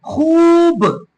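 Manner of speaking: खूब with a long, held oo; drawn-out vowel. Shown as a demonstration. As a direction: stretch the vowel in खूब right out. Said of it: "खींच कर पढ़ा जाता है".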